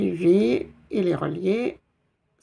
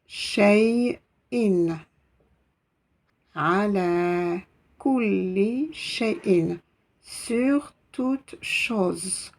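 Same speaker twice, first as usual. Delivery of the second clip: lecture très lente; much slower than usual. Shown as a demonstration. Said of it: "lecture lente".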